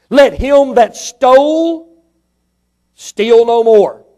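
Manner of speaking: very slow, weighty reading of verse; slow scripture reading. Acted. Preacher reading the verse to the church, very slow. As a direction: lay each word down slowly.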